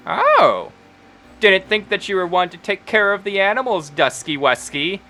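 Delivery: high-pitched, teasing proprietor voice; teasing halfling Wendy. Acted. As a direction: coo at a customer with a high, surprised "oh".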